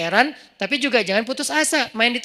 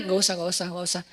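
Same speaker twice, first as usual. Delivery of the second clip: low, soft mock refusal; understated, lowered brush-off; feigned refusal; 4 semitones lower, 7 dB quieter than usual. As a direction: wave the offer away quietly. Feigned refusal of escort retold.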